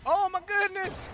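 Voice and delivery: squealing high and loud; excited squeal at the raid; cute anime streamer. Real, not acted. Imp raid arrives, excited squeal.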